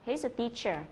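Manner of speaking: "he's a teacher" with the "'s" left weak; unstressed IS. Shown as a demonstration. Teacher shows learners a main-verb to be, "he's" said without stress on "is". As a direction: glide over the "is" in "he's a teacher". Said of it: "các bạn sẽ không nhấn vào cái từ is".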